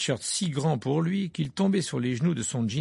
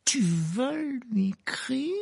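high, slow, stammered question hiding fear; frightened uncle forcing calm about Sirius; forced calm; 12 semitones higher, slower than usual. A narrator's reading. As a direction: fight to sound calm while scared. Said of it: "d'une voix qu'il s'efforçait de rendre le plus calme possible".

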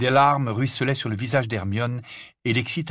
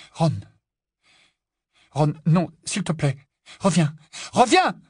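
high plea rising to a cry; pleading; 6 semitones higher than usual. A narrator's reading. Hermione begs Ron to come back, pleading. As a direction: beg him, building on the last word.

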